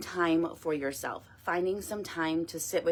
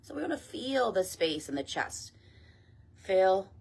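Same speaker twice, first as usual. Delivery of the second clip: guided meditation; 6 dB quieter than usual. Acted. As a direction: ease them gently down, phrase by phrase. Leads meditation and breath work.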